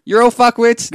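high, very loud mock insult; cox's abusive shout. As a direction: yell abuse at the crew for laughs. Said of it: "what else would they say?".

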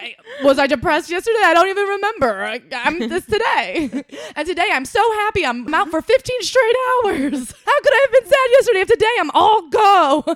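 high, loud, laughing mock of a scatterbrained friend; manic imitation of cohost. Host imitating her cohost's carefree attitude, high loud laughing mock. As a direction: gush like someone who forgets yesterday, laughing. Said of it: "You're like".

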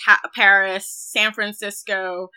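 usual speaking voice

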